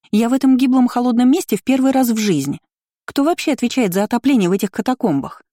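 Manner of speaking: affronted lady working herself up; flaring up. A narrator's reading. Aristocrat complaining about freezing catacombs, high and indignant. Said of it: "Тут же взвилась аристократка".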